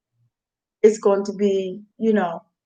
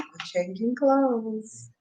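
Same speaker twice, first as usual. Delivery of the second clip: slow, soft aside; quiet; 9 dB quieter, much slower than usual. Real, not acted. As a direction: drop it softly and slowly.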